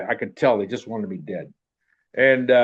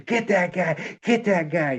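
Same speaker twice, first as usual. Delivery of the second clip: high mock yell of an angry officer; high-pitched mock shout; retold screaming; 8 semitones higher, slower than usual. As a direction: imitate him yelling across the arena, pitched up. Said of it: "he starts screaming".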